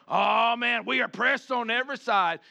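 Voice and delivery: hammed-up preacher moaning about hardship; mock gloomy preacher. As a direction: play a preacher wallowing in troubles, loud. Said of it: "the preacher will get up there".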